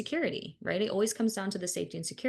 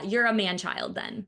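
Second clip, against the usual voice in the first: usual speaking voice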